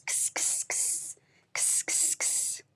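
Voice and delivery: unvoiced kss snipping noises; whispered scissor sound effect; scissor snips. Shown as a demonstration. Teacher making scissor noises for the letter, whispered.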